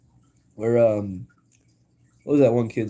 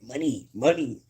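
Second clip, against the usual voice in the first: slow, swooping cartoon chant; Mr. Krabs; 3 semitones higher than usual. Streamer quoting a crab meme, drawn-out cartoon voice. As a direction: draw out each word like a meme catchphrase. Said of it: "that Mister Krabs meme".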